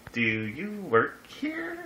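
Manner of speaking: slow, high do you work here; slow puzzled question. Retold or imitated. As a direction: stretch it out, baffled by the tray. Asks date carrying tea tray.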